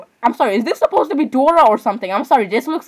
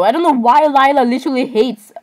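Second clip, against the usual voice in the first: usual speaking voice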